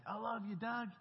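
imitating an inmate's fond goodbye, pitched high; affectionate farewell call. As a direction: call "love you" in a high mock voice. Retold affectionate farewell called across jail.

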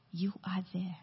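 breathy hushed whisper, barely voiced. Preacher quoting the psalm to the congregation, whispering. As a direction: let the words fall to a whisper.

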